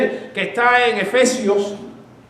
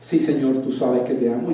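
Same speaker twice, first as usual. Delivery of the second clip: sad and humble; 9 semitones lower, 6 dB quieter than usual. Retold or imitated. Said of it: "fue más humilde".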